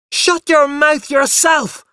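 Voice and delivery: put-on high, loud, drawn-out child's shout; mad with anger. A narrator's reading. Boy defying his father, mad with anger. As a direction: yell back as a furious small boy. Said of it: "I shouted, mad with anger".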